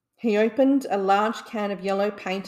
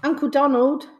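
higher, louder call to their uncle; eager cry; 6 semitones higher, 6 dB louder than usual. A narrator's reading. Nephews excitedly calling their uncle, a raised eager cry. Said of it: "cried Huey".

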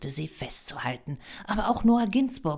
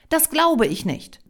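higher, louder burst of disbelief; indignant disbelief; 11 semitones higher, 8 dB louder than usual. A narrator's reading. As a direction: protest the rejection loudly. Applicant objects to sudden rejection.

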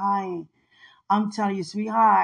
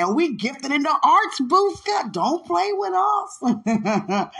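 playful boast; 8 semitones higher, 3 dB louder, slower than usual. Acted.

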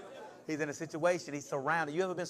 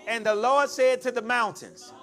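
raised, building preacher's repetition; rhythmic preaching; 6 semitones higher, 11 dB louder than usual. Real, not acted. Preacher testing the verse on the congregation, building force. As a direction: build each repeated phrase toward a loud finish.

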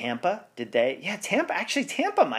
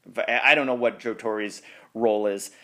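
normal talking voice

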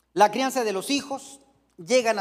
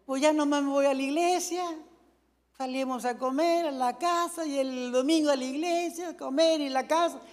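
slightly higher put-on singsong; hint of bored retiree; 4 semitones higher, 3 dB quieter than usual. Retired churchgoer listing the weekly routine, lightly mock singsong. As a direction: lightly mimic someone reciting a routine.

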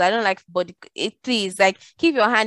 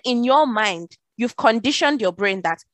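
usual speaking voice

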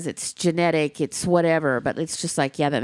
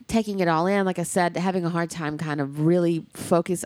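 ordinary delivery

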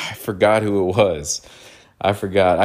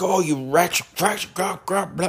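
a hammed-up cartoon baddie sneering, pitched higher; mock a ranting cartoon foe; hostile cartoon villain; 9 semitones higher than usual. Cartoon villain ranting at Bugs Bunny, lightly voiced and higher. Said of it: "They'd be like".

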